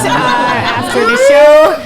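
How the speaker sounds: high, loud, slow comic yell; shouting. Acted. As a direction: blurt it out in a big yell. Joke about throwing up.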